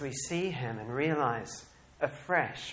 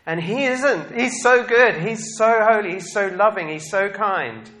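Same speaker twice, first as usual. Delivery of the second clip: exultant praise; 7 semitones higher, 9 dB louder than usual. Real, not acted. Preacher exulting about Jesus' goodness.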